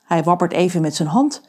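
ordinary delivery

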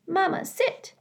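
put-on high, small child voice; a baby bird asking his mama; little gosling. Gosling asking his robot mother to sit, high and small.

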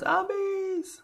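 high squeaky cry of zombies; mock alarm. Player joke shout.

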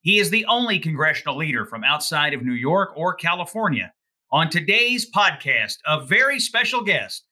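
upbeat announcer-style intro read; podcast intro. Acted. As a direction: open the show like a radio host.

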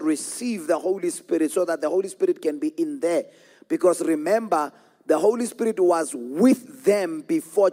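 insistent pulpit teaching in rolling phrases; forceful preaching. Real, not acted. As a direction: preach it to the congregation, punching key words.

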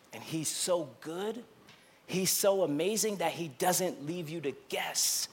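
drops to a near-whisper, tender; quiet pastoral hush; hushed sermon. Real, not acted. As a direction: soften right down, close and intimate. Quiet intimate stretch of sermon.

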